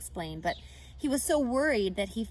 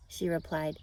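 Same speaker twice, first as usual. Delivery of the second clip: usual speaking voice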